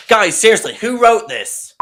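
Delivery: loud, very high-pitched protest; exasperated outburst. Acted. Host demanding who wrote the script, exasperated. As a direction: call out the writers in exasperation.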